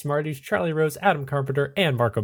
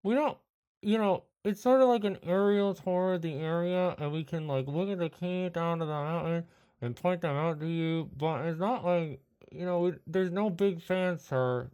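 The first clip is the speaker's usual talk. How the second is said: lightly higher, softer clerk; a hint of a weary phone agent; travel agent voice; 4 semitones higher, 6 dB quieter than usual. GM plays travel agency worker.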